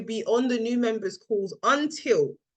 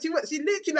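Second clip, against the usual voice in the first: normal talking voice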